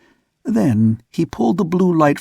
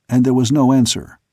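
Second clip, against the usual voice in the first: usual speaking voice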